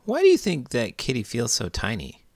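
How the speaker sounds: usual speaking voice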